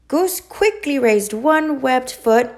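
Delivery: usual speaking voice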